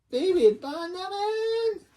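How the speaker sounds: put-on very high, drawn-out Chinese-accent screech; mock Chinese accent. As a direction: stretch each word high in a hammed-up Chinese accent. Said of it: "I'm just using it because".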